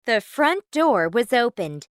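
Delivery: ordinary delivery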